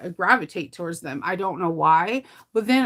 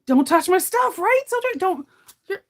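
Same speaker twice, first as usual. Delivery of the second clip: higher, louder whiny don't touch my stuff; hamming up a possessive protest; mock protest; 10 semitones higher, 4 dB louder than usual. Mom mimicking herself guarding shoes, higher whiny mock protest.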